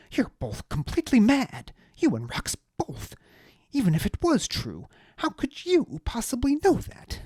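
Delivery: high, loud, outraged aristocrat ranting; indignant high-voiced noble. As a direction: spit out the betrayal in a high indignant voice. Deliritus voice.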